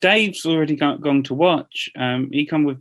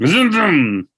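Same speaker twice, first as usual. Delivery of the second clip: zoom zoom; 4 semitones higher, 5 dB louder than usual. Pretend. Playful car noise.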